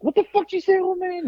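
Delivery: high-pitched mock outrage, retelling a bar quarrel; indignant challenge. Drunk customer confronts the old biker, acted indignant challenge. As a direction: shoot the question back, voice way up.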